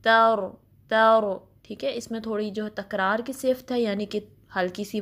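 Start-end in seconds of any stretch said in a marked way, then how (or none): 0.0-1.4 तर with a heavy, full ra and a slight trill; loud demo of तर with a thick ra; heavy rolled ra. Shown as a demonstration. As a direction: roll the ra of तर lightly, heavy and full. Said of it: "रॉ को heavy पढ़ना है".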